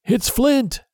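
a pirate cries out in fear at the ghostly song; frightened cry. A narrator's reading. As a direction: blurt it out in sudden terror. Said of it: "one cried out".

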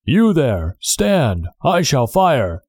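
high guard's call, a restrained roar; roared challenge. A narrator's reading. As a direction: challenge a stranger from a distance. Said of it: "as loud as he could roar".

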